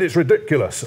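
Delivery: put-on indignant local councillor, lightly done in a Northern accent. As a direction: quote the old councillor in a mild Northern accent. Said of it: "I'll put a Northern accent on".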